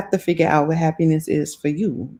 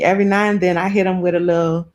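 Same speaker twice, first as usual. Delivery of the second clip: ordinary talk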